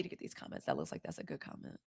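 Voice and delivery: breathy whisper to herself; whispered aside. Real, not acted. Reading comments to herself, whispering. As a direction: reads comments under her breath.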